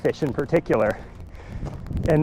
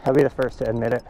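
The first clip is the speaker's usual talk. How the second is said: softer, quick, out of breath; panting after a steep climb; 3 semitones lower, 4 dB quieter, faster than usual. Real, not acted. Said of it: "give me a second to catch my breath".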